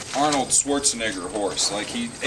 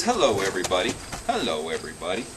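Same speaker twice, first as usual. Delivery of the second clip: lower, playful voice for the horse; horse's voice; 7 semitones lower, 6 dB quieter, faster than usual. Pretend. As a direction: voice the horse greeting the viewers, lower and softer. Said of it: "He says".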